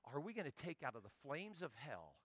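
quiet morning prayer asking God for the day, soft. Retold or imitated. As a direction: pray it softly to God. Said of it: "my day is".

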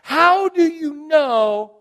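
very slow, high, loud cry; exasperated outburst. Retold or imitated. Pastor finally asking the teller, drawn-out loud outburst. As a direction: blurt it out, each word stretched high. Acting out his burst-out question.

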